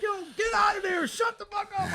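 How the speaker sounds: high mock shout at the screamer; high hammed-up yell; neighbours yelling. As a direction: bark at the guy in the tree.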